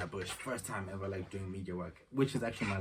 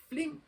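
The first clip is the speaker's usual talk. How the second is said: squeaky whoosh effect; 4 dB louder than usual. Retold or imitated. Sound effect for sending work.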